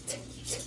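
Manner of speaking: "t, t," as unvoiced puffs of air; whispered t sounds. Shown as a demonstration. Therapist isolates the t sound for Alex, whispered. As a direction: tap out a breathy t, t. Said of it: "We really wanna emphasize the t".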